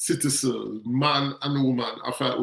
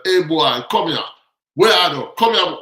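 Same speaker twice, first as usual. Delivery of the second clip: barked, loud and higher, like a cop hauling someone over; rough police shout; 3 semitones higher, 8 dB louder than usual. Retold or imitated. Police officer yelling at a young man in the street, rough loud shout. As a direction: yell it at him like a disrespectful officer.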